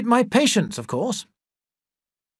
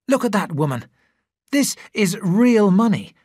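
raised, eager and proud; excited; 6 semitones higher, 4 dB louder than usual. Acted. Andrew shows his wife the cheque, excited and proud. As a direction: show off the cheque, thrilled. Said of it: "he excitedly showed Christine".

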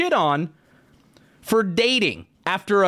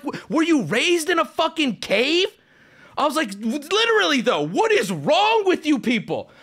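raised, incredulous scolding; indignant retort; 8 semitones higher than usual. Retold or imitated. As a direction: demand it of them, appalled. Outraged retort to kids.

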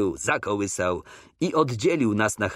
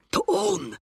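cried out. A narrator's reading. Coretti spotting the king's carriage, high excited cry. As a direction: exclaim high in sudden recognition. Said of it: "krzyknął Coretti".

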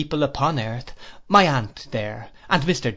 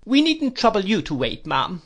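put-on a touch of an imperious old lady, higher; stern aunt, lightly voiced; 8 semitones higher than usual. A narrator's reading. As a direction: scold the nephew briskly. Aunt dismisses Mrs Crupp.

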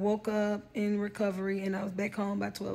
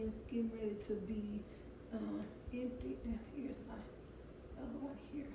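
whispering; 16 dB quieter, faster than usual. Real, not acted. Showing drains during recording.

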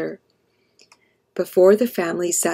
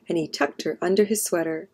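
ordinary delivery, nothing marked

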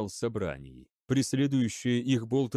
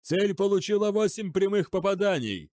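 high, urgent call; shouted report; 8 semitones higher than usual. A narrator's reading. Chief gunner reporting eight hits, shouting. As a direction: call the hits across the bridge. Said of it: "крикнул главный комендор".

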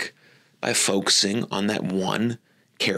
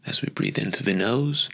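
gently paced, unhurried phrases that follow the breath; guided meditation. Acted. Teacher guiding listeners into attention on their breath, guided meditation.

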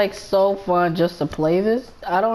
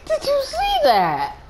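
very high, slow squeal; laughing disbelief; slower than usual. Real, not acted. Player after a man gets run over, laughing disbelief.

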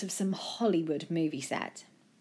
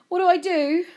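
high, louder nervous question; 13 semitones higher, 8 dB louder than usual. A narrator's reading. Joe asking his friend for help, nervous and high. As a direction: ask it nervously, pitch right up. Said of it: "Joe said nervously".

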